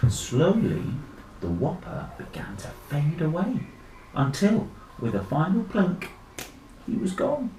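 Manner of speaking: ordinary delivery, nothing marked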